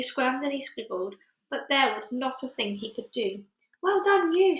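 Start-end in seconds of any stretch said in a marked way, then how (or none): 3.8-4.6 high, bright cheer; cried. A narrator's reading. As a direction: cheer him on, delighted. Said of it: "Sophie cried".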